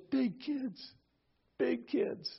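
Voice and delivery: small, high, soft tearful voice; imitated crying child. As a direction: whimper it softly like a little boy in tears. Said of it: "He just started crying".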